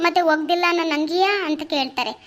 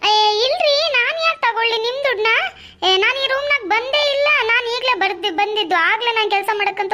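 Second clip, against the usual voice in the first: indignant denial; 4 semitones higher than usual. Acted. Wife denying the theft to husband, indignant. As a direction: push back, she's been accused. Wife denies taking the money.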